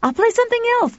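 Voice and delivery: put-on very high boy's voice; high boyish reply; eager boy. A narrator's reading. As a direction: answer eagerly, pitched right up. Boy answers, running upstairs.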